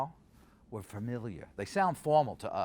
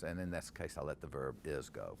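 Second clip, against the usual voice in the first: usual speaking voice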